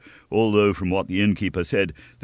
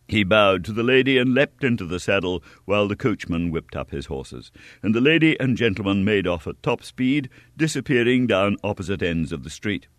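ordinary delivery, nothing marked

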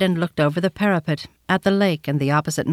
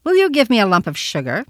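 put-on small boy's hard, raised voice; sharp little voice; 4 semitones higher than usual. A narrator's reading. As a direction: pipe up sharply, like a demanding little boy. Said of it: "he asked in a sharp, hard little voice".

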